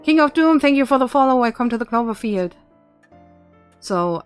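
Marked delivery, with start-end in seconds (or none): 0.0-2.5 high, loud cheerful thanks; excited. Real, not acted. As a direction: thank the follower brightly.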